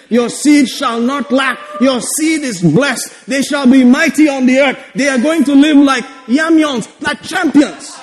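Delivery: rhythmic, insistent blessing; declamatory preaching. Acted. Preacher blessing the congregation's children, declamatory preaching. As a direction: proclaim it over the congregation.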